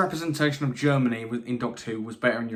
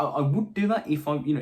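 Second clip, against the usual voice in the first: ordinary talk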